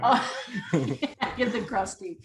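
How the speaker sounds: laughing through Agatha Christie. Real, not acted. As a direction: laugh while saying it. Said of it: "I was thinking Krusty the Clown".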